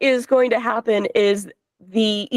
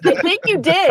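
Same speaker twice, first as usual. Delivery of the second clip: laughing; 3 semitones higher than usual. Real, not acted. Laughing at teammate's blunder.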